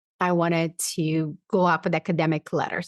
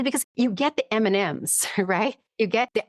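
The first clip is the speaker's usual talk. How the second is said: normal talking voice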